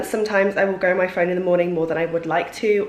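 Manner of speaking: normal talking voice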